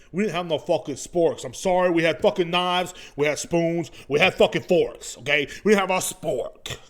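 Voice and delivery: heated, rising complaint; angry rant. Real, not acted. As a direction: vent about the missing sporks. Ranting about the spork complaint.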